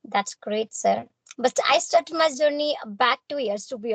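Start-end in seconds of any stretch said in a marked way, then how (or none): none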